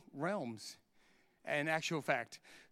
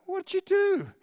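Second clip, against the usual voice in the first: high, loud accusing disbelief; shocked wardsmen; 12 semitones higher, 6 dB louder, slower than usual. Retold or imitated. Wardsmen turning on the preacher in disbelief, high shocked blurt. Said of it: "two other wardsmen turn to me and go".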